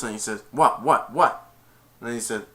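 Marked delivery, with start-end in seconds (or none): 0.5-1.5 three loud, separate jabs; acted indignation. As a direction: fire it back as the offended coach. Retold challenge in quarrel.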